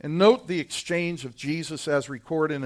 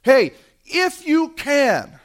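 high, loud and slow, a father at the end of his rope; desperate plea; 11 semitones higher, 8 dB louder, slower than usual. Retold or imitated. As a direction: beg it out, slow and strained. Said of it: "he comes with desperation".

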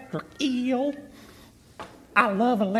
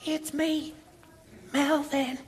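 whispering; 6 dB quieter than usual. Acted. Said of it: "why are we whispering?".

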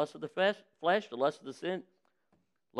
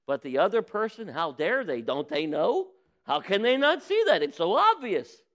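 rising outraged disbelief, climbing higher and louder; mock indignation; 3 semitones higher, 4 dB louder than usual. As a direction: play the self-righteous hypocrite, scandalized. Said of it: "Indignant of others' faults".

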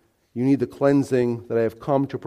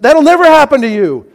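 high, loud outburst of refusal; loud protest; 12 semitones higher, 10 dB louder than usual. Acted. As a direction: cut in, flatly refusing to allow it. Acts out Peter's indignant protest.

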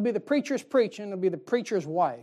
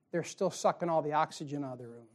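ordinary talk, nothing marked

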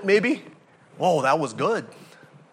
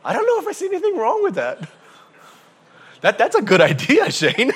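much higher, laughing voice; mock sheepish men; 10 semitones higher, 5 dB louder than usual. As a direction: ham up the guys agreeing. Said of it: "guys imitated, continuing".

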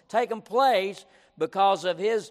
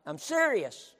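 usual speaking voice

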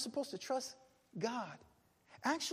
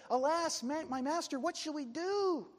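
high, raised wail of dismay; crying out in alarm; 7 semitones higher, 3 dB louder than usual. Retold or imitated. Servant seeing the army surrounding the city, alarmed cry. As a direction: cry it out, drawing out the question. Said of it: "he cries and he tells Elisha".

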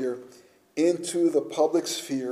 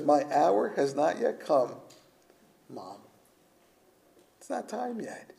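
gentle reply; 7 dB quieter than usual. Acted. Jesus answering his mother, acted.

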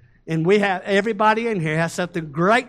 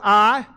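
"I" hit loud and held for the crowd to answer; a loud cue word "I" for a call and response; stress on I; 7 dB louder than usual. Shown as a demonstration. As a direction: punch "I" and pause so they can shout God. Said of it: "as soon as I say, "I," I want you to say "God".

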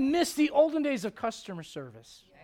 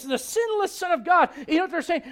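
ordinary talk

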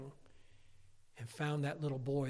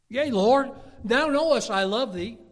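higher, much louder fervent vow; Peter's declaration; 8 semitones higher, 12 dB louder than usual. Retold or imitated. Peter declaring his love, higher and much louder. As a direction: cry it out to the Lord with full conviction. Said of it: "Peter said".

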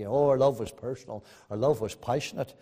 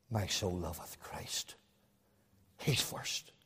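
soft, low and slow, trailing into a whisper; hushed; 6 semitones lower, 12 dB quieter, slower than usual. Acted. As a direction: say it like a private prayer. Intimate confession of love.

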